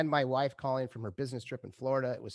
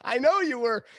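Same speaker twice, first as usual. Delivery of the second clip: amused agreement; 9 semitones higher, 6 dB louder than usual. Retold or imitated. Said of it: "And I said".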